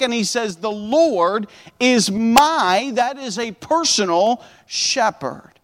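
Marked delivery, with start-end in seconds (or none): 0.6-2.9 "The Lord is my" drawn out slowly, leaning hard on "my"; emphatic, stress on "my". Acted. Preacher showing the psalm is personal, slow emphatic stress on "my". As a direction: stretch "Lord" and "my", holding "my" for emphasis. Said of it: "it's very personal and it's very emphatic".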